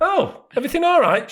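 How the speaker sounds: high, falsely innocent enquiry; swaggering. Retold or imitated. Husband strolling down to wife's disaster, smug mock innocence. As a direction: saunter in with smug mock concern. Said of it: "with a little bit of a swagger".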